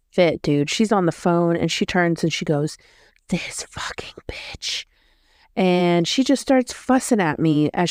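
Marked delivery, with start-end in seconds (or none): 3.3-4.8 slow, hushed whispered curse; whispered insult. Retold or imitated. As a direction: whisper it like a venomous aside, imitating her. Retold aside cursing her on phone.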